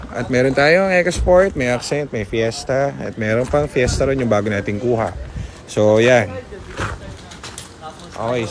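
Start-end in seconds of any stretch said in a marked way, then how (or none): none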